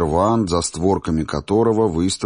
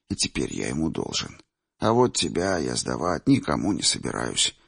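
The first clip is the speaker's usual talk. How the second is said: put-on old man voice; 4 dB quieter than usual. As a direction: speak softly as an old man. Old seer Chimir speaks.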